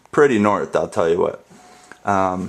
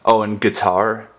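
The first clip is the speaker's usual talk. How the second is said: ordinary talk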